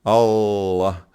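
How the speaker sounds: Allah said with the double L lengthened; Allah com L duplo; Allah with long L. Shown as a demonstration. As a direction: demonstrate Allah with a held L. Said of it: "é mais Allah".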